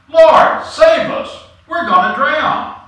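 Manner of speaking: loud, high cry for rescue; shouting. A narrator's reading. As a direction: shout it out like drowning men begging for help.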